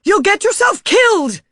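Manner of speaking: loud, high frightened scream. A narrator's reading. Margaret clinging to narrator's leg, screaming in fear. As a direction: plead at the top of her voice. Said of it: "screamed Margaret".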